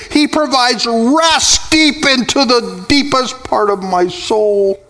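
high, sustained preacher's cry, words stretched out; soaring revival-sermon delivery; impassioned preaching. Acted. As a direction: proclaim it fervently at a high pitch, riding each phrase. Impassioned preaching at full force.

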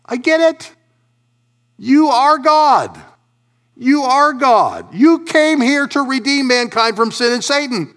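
high, loud cry of realisation; acted revelation. Rich ruler finally recognising God, high and loud. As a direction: cry it out as if it just dawned on you. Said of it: "where he should have said".

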